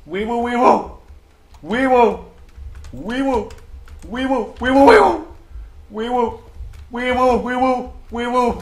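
very high, loud wee woo siren; loud gleeful siren; siren imitation. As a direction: blare it like an ambulance. Siren noise celebrating loot.

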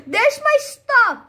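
gasp, then high, loud, slow joy; gasping delight. Real, not acted. Gamer finding his dropped stuff, gasping with joy. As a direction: burst out with relieved delight. Finds lost items.

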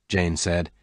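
ordinary delivery, nothing marked

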